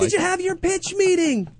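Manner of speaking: high, put-on squeal; mock a vapid industry question; high mocking LA chatter; douchey LA partygoer. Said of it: "saying all these douchey L A".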